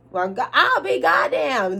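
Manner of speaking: slow, high country exclamation; country accent. Acted. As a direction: drawl it out in mock country. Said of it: "I'm gonna cut the accent".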